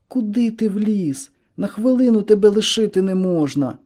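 exasperated, desperate high-pitched cry; full of despair. A narrator's reading. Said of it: "запитав голосом, повним відчаю".

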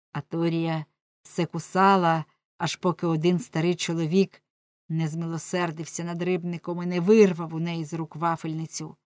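ordinary delivery, nothing marked

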